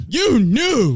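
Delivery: "You knew" shouted high and loud; shouted accusation. Real, not acted. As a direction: yell "You knew" at them. Repeated louder and higher.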